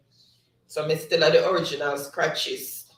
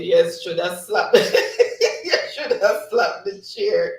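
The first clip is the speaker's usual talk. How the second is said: cracking up, louder on the laugh; 3 semitones higher, 5 dB louder than usual. Real, not acted. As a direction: read it while breaking into real laughter. Cracks up reading chat comment.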